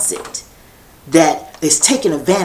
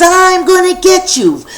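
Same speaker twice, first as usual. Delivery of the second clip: high, loud chase call; a kid hollering a threat, much higher and louder; shouted threat; 12 semitones higher, 6 dB louder than usual. Acted. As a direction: yell after him as you chase.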